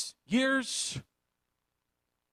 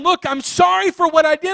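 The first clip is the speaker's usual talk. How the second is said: voiced apology; 9 semitones higher, 7 dB louder than usual. Child apologizes to parents.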